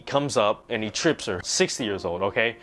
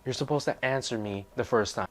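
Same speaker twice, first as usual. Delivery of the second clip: softer, put-on scolding teacher voice; 6 dB quieter than usual. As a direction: scold him under her breath like the teacher. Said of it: "she's like".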